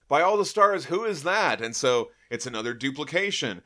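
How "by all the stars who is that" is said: high, loud startled gasp of a line; startled exclamation. Retold or imitated. Surfer startled by his own double, high loud exclaim. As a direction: recoil at seeing a double.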